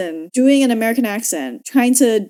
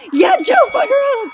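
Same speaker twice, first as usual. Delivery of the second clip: much higher and louder, yelling encouragement; cheering shout; 5 dB louder than usual. Real, not acted. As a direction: hype her up like a fan at a fight.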